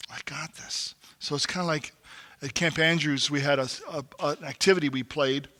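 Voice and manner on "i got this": breathy aside under the breath; hushed mock self-reliance; whispered "I got this". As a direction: mutter it to yourself. Repeating the men's catchphrase.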